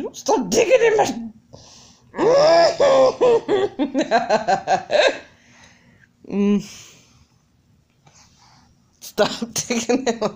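high squealing laughs; laughing. Real, not acted. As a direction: break into giggles mid-sentence. Laughing while baby digs.

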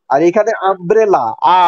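ordinary delivery

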